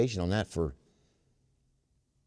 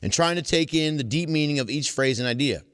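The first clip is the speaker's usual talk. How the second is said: reading aloud; 5 semitones higher, 3 dB louder than usual. Acted. Reading aloud from the book to the meeting. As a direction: read the book passage to the group. Reads passage again.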